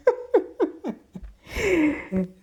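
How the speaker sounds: high breathy burst of laughter. Real, not acted. Host cracking up at his DJ bit, high laugh. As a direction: laugh at your own joke.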